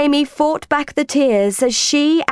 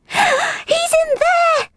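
very high, drawn-out cry of discovery; excited cry; much slower than usual. A narrator's reading. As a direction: cry out high, as if you've just found him. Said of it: "cried Amy".

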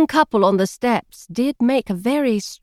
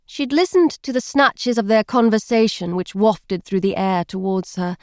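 plain reading voice

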